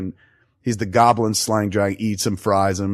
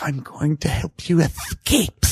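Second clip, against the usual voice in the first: lightly voiced conspiratorial kid; 5 semitones higher, slower than usual. Kid whispers to Voldemort.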